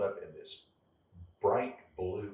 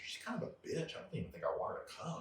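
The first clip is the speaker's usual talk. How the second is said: hushed, breathy confidence with a giggle; sneaky whispered aside to sister; whispered gossip; 8 semitones higher, 6 dB quieter, faster than usual. Retold or imitated. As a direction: whisper it like a guilty secret.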